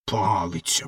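the proud rocket insulted at being called a stick; disgusted repeat. A narrator's reading. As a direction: wrinkle your nose at the word. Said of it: "скривившись".

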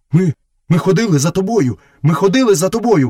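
high, apologetic wolf voice; muttering wolf brother. A narrator's reading. Wolf assuring Mowgli he followed him, high-pitched and pleading. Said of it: "бурмотів сірий брат".

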